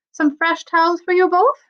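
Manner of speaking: high, sing-song Irish brogue; cheerful Irish steward offering towels; jolly Irish accent. Acted. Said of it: "Irish accent ... like a jolly song".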